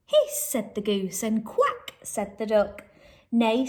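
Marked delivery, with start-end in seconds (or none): 0.1-0.5 high, loud goose hiss. Acted. Teacher reading to children, a high goose hiss. 1.4-1.9 squeaky high quack; high duck quack. Acted. Teacher reading a farm story, a high duck quack. 3.3-3.7 louder neigh; horse neigh. Acted. Teacher reading a farm story, a mock horse neigh.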